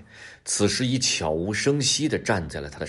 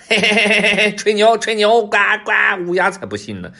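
high, loud jeering crow voice; mocking crow squawk, very high; loud cawing crow; 12 semitones higher, 9 dB louder than usual. A narrator's reading. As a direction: caw and taunt at the top of your voice. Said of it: "大声叫着飞走了".

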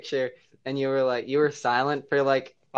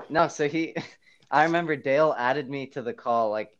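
ordinary talk

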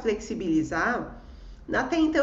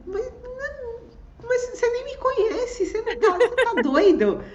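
incredulous; 12 semitones higher, 4 dB louder than usual. Retold or imitated. Incredulous questioning of him.

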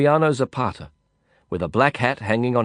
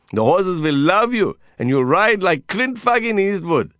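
put-on high-pitched, rousing Indian-accented pep talk; exuberant Indian cowboy; 6 semitones higher, 3 dB louder than usual. Cowboy friend talking Lin into dancing, exuberant and high. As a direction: rouse him with excited swearing. Voices Vikram in the dialogue.

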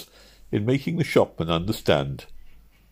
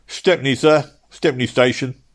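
raised, louder cabman's call; 7 semitones higher, 6 dB louder than usual. Acted. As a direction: call it down to your passenger. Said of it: "cab called down".

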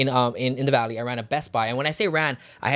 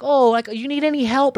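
salesman approach; 8 semitones higher than usual. Retold or imitated. Acts out approaching a store customer.

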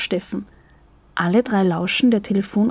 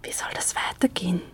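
much softer, breathy whisper; 2 semitones lower, 9 dB quieter than usual. A narrator's reading. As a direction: whisper it so he can't hear. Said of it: "Kathi flüstert Franzi zu".

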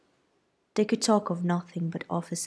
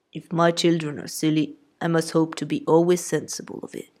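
deadpan husband's retort; dry father; 5 dB louder than usual. A narrator's reading. Mr Bennet voiced.

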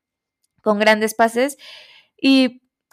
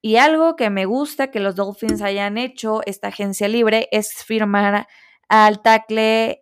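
ordinary delivery

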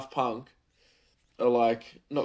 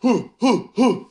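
coughing gasp; 13 semitones higher, 13 dB louder than usual. Real, not acted. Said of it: "excuse me".

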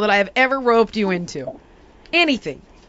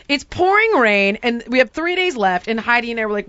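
ordinary delivery

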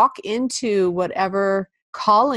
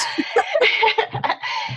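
high breathy giggle; embarrassed laugh. Real, not acted. Embarrassed about the name.

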